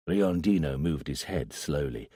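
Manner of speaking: ordinary delivery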